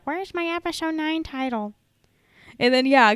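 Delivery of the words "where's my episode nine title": whiny fan voice. Retold or imitated. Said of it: "every single Star Wars post being like".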